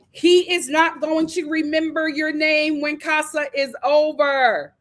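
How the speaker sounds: normal talking voice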